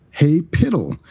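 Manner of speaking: put-on a friendly shout-out to an old colleague; calling out. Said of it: "somebody yells out".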